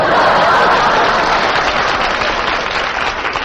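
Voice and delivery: long chuckle; hearty laugh. Real, not acted. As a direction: laugh at your own gag. Laughing at his own joke.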